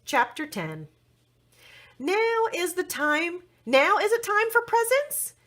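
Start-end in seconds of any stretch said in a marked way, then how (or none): 2.0-5.3 high, eager question; Apple Blossom voice. A narrator's reading. Apple Blossom begs to open presents, high eager voice. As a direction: ask it up high and hopeful. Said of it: "asked Princess Apple Blossom".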